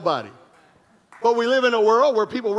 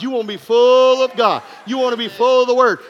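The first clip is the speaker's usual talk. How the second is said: louder, hammered exhortation; preaching shout; 2 semitones higher, 6 dB louder than usual. Acted. Pastor exhorting his church, loud and forceful. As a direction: drive it home to the church.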